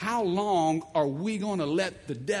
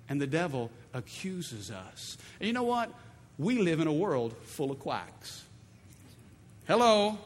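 normal talking voice

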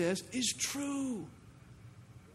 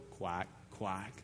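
low mocking quack, quack; duck quacks; 10 semitones lower, 12 dB quieter than usual. Preacher voicing the devil as a duck, low mocking quack.

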